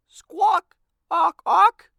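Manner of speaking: very high, loud parrot squawking; parrot squawks. A narrator's reading. Parrot Igor squawking through the library door, loud. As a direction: screech like an excited parrot.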